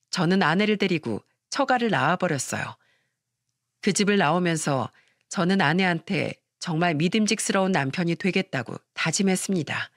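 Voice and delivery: plain reading voice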